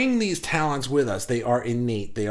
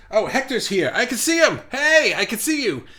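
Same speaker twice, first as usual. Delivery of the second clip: much higher, louder, delighted greeting; excited; 8 semitones higher, 4 dB louder than usual. Real, not acted. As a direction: greet him with a happy shout. Spotting a viewer online.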